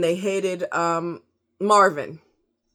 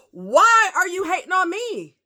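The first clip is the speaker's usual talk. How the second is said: angry rant; 11 semitones higher, 4 dB louder, slower than usual. Retold or imitated. Ranting at Mexican security.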